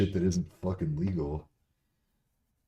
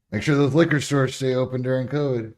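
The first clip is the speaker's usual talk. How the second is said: sarcastic; 8 semitones higher, 9 dB louder than usual. Real, not acted. Sarcastic mock advice.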